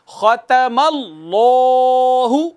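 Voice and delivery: drawn-out tajweed recitation of ختم اللہ with heavy لام; slow recitation. Shown as a demonstration. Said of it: "تو دیکھیے".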